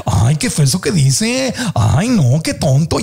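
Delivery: lightly voiced judging child, whiny swoops; little inner voice. Adult's inner child voice judging others, lightly whiny. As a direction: judge with a hint of a whiny kid. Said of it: "cuando mi vocecita me habla".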